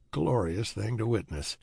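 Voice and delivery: ordinary delivery, nothing marked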